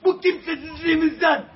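high, loud begging cry to the President; real anguish, calling for help; desperate shouting plea. Real, not acted. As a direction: yell the plea with everything you have.

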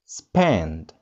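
ordinary talk, nothing marked

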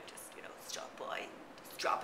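hushed whisper of a hint. Acted. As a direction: whisper it like a secret. Hinting for visitors.

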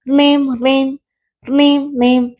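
high, drawn-level ringing noise; phone ring imitation. String phone ringing for the kite, imitated ringing. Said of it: "നൂൽ phone അടിച്ചു".

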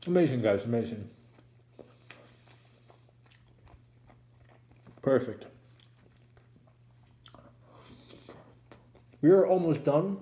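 chewing while talking; talking around food; mouth full. Real, not acted. Seen 3: Cheeks bulge, lips working, bitten burger held while speaking.

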